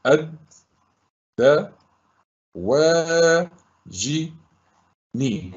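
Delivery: each syllable alone, long pauses between. Shown as a demonstration. Teacher breaking a word into syllables for pupils, sounded out. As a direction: sound it out, holding the long vowels. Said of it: "كما نطقتها".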